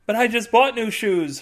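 high, protesting exclaim. A narrator's reading. Ukrainian woman protesting to the embassy man, high exclaim. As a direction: blurt it out in dismay. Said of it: "she exclaims".

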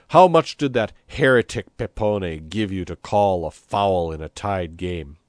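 loud, slow, emphatic accusation; furious interrogation. A narrator's reading. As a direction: demand the truth with mounting anger. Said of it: "he said furiously".

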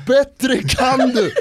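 put-on kid screaming. Kid taunting Zlatan after the penalty, laughing yell. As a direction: jeer it with a laugh. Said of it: "en annan unge skriker".

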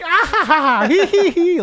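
high, loud ha-ha into hee-hee; childlike mock giggle. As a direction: giggle like a child, imitating the guy. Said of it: "giggling like a child".

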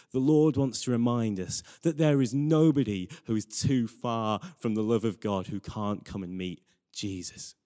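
normal talking voice

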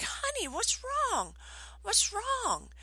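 very high, cooing concern; high, soothing mommy voice; retold concerned mom. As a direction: re-enact a mother fussing over a crying child. Worried mother to bawling child.

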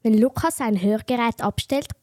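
normal talking voice

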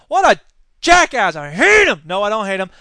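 exasperated outburst. Real, not acted. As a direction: explode at his nerve. Outburst at Walker, then taken back.

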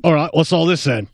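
gravelly mock London bobby accent; bad Londoner accent. Shown as a demonstration. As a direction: put on a hammy London accent with some gravel. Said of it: "do a bad Londoner accent".

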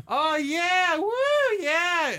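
high-pitched mock crowd whoops; kids cheering. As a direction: imitate children cheering on a game. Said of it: "kids kind of just stand around him going".

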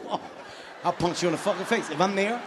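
ordinary talk, nothing marked

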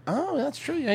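normal talking voice

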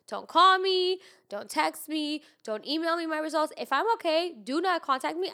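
emphatic. Real, not acted. Emphatic demand.

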